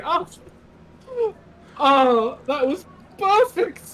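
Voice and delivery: high, loud laughing praise; laughing delight. Acted. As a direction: gush through laughter like a delighted bard. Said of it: "The Tabaxi minstrel just starts laughing".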